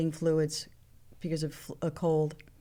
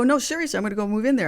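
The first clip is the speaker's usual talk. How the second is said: mock insistent; 4 semitones higher, 5 dB louder, much faster than usual. Insisting jokingly to Prince.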